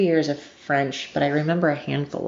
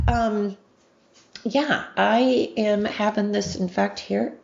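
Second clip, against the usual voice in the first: ordinary talk, nothing marked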